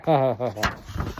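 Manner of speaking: laughing. Acted. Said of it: "I cannot contain my laughter".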